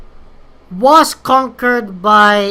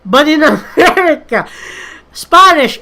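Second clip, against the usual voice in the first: louder, higher, a laugh breaking into the word; 6 semitones higher, 4 dB louder than usual. Real, not acted. Telling viewers how America differs from home, laughing. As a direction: let a laugh burst through mid-phrase. Laughs while making the contrast.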